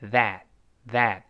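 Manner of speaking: THAT with an open "a" as in cat, final t unreleased; open middle vowel. Shown as a demonstration. Teacher drilling the vowel for students, open and deliberate. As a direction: draw out the vowel in "that". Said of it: "注意中间发哎".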